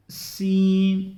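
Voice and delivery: सीन drawn out slowly with a light whistling s; light whistle seen. Shown as a demonstration. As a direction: hiss the s of सीन lightly and long. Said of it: "हल्की सी सिट्टी निकालनी है".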